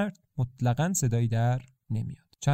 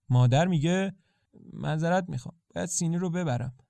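ordinary delivery, nothing marked

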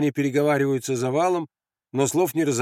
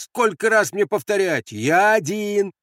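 high, raised protest, slowing at the end; exasperated shout; 8 semitones higher, 4 dB louder, slower than usual. Acted. Andres asked once more if he is alone, shouting. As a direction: shout it up, fed up. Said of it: "крикнул Андрес".

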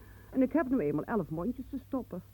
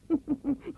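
short laugh; 8 semitones higher than usual. Acted. Sister laughs at claim.

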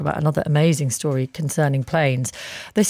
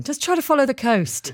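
air traffic controller; 7 semitones higher than usual. Retold or imitated. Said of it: "That's my favourite line".

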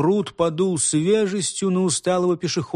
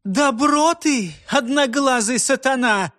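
higher, slow, accusing cry; angry outcry; 7 semitones higher than usual. A narrator's reading. Sister-in-law rounding on the one-eyed head, furious cry. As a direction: fling the insult at him. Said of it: "вскричала она".

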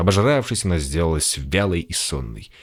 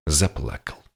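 plain reading voice

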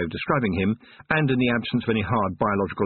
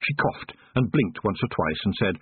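ordinary delivery, nothing marked

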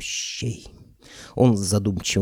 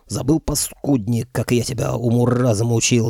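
usual speaking voice

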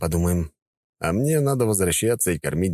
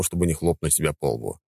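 plain reading voice

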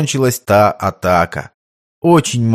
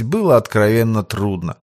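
ordinary delivery

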